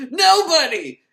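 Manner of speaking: sudden high, loud yell; mock emphatic shout. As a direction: bellow the word like a warning. Stressing the secret, comic outburst.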